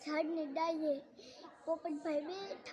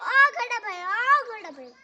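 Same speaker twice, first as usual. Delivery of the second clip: loud high shout; calling out; 6 semitones higher, 15 dB louder than usual. Acted. Rabbit shouts again to the horse who can't see him, loud call. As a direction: yell across to be heard. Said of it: "પાછી બૂમ પાડી".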